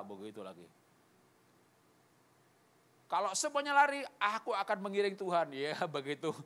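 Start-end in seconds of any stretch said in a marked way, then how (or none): 3.1-5.5 high, loud declaration of loyalty; bold vow. Acted. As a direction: proclaim it boldly, pitched up. Acting out Peter's bold vow. (ikrar lantang dan berani)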